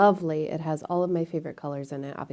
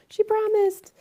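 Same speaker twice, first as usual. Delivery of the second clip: much higher, bright sing-song repeat; high playful. Acted. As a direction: say "She promised." high and teasing. Repeat after whispered take.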